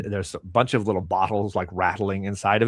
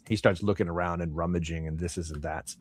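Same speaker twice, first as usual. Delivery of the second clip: ordinary talk, nothing marked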